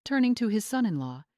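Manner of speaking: ordinary delivery, nothing marked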